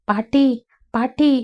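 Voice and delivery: calling grandma out in a hurry, rising; eager, breathless call to grandma; excited calling. Acted. Vidya rushes in calling her grandmother, excited. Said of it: "பரபரப்புடன்".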